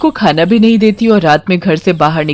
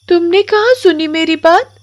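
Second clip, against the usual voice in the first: put-on high child voice, gentle and wondering; curious little girl; 10 semitones higher than usual. Komal gently asks the crying witch, high child voice.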